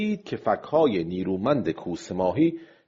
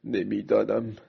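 lightly voiced fisherman thinking things over; a hint of character in pensive self-talk; musing old fisherman; 3 semitones higher than usual. Old fisherman's inner thoughts. (ماهیگیر پیر، کمی نمایشی، غرق فکر)

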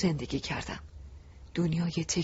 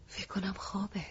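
whispered aside; a soft whisper by the sickbed; under her breath; 3 semitones higher, 9 dB quieter than usual. Acted. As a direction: breathe it out barely audible. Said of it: "زیر لب گفتم".

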